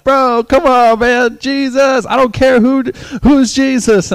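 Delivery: high, loud exasperated yelling; re-enacted heated holler; acted shouting match. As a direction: shout over each other in protest.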